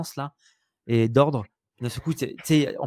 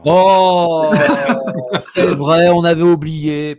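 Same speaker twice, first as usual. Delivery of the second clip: loud, laughing "oh" of fake dismay; mock contrite outcry; 10 dB louder, much slower than usual. Joking apology to a forgotten star, loud and mock-sorry. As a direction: play up a big jokey apology.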